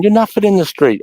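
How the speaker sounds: high-pitched jeering imitation; mock taunt. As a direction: mimic a drunk recruit's challenge. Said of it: "Calling me a bully, saying I'm nothing".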